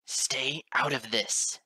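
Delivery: loud, slow threatening growl. A narrator's reading. Father warning off the teacher, loud slow growl. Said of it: "Straight Edge growled".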